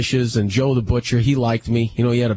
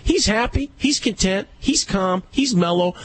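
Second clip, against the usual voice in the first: insisting, voice pitched up high; worked up; 11 semitones higher than usual. Real, not acted. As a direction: hammer the point home, riled up. Heated argument about dog collar.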